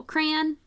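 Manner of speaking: crayon squeezed into one syllable, rhyming with man. Shown as a demonstration. Teacher showing how she says it, one-syllable crayon. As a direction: say crayon as "cran". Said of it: "as one syllable".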